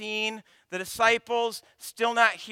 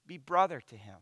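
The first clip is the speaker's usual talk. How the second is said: ordinary talk, nothing marked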